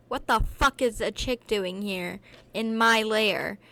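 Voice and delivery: slow, loud furious roar; a bellowing Eric, outraged at the girl in his lair. Acted. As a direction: bellow it at the intruder. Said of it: "Eric roared".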